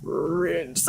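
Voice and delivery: lightly deepened DJ ident, mostly her own voice; Rinse FM presenter impression. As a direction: drop the voice a bit and announce it like the station ident. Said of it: "Give me your best Rinse F M impression".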